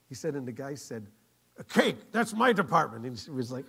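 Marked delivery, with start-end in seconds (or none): 1.6-3.0 eager diner owner. Retold or imitated. Diner owner claiming the cake job, eager. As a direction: claim the job loudly and proudly.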